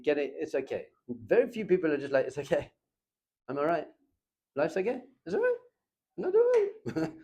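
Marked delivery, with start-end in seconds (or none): 2.3-7.1 mock breezy denial, cracking up high at the end; mocking carefree voice. Rabbi mimics someone pretending life is fine, mock breezy. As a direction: play someone insisting they're fine until the voice breaks.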